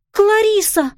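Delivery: put-on high, loud cry of a girl's name; shout. A narrator's reading. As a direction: call out to her stepmother, alarmed. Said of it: "закричала она". (громкий высокий крик)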